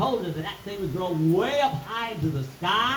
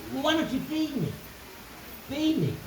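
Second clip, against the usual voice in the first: little Jackie pleading; 6 semitones higher, 4 dB quieter than usual. Acted. Hungry little Jackie asks for food.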